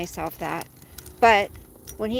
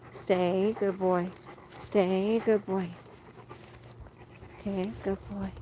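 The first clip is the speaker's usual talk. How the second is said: soft, low-key stay, rising; calm the dog into staying; gentle praise for a waiting dog; soothing dog command; 3 semitones lower, 7 dB quieter than usual. Real, not acted.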